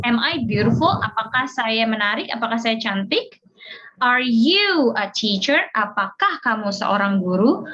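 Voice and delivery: usual speaking voice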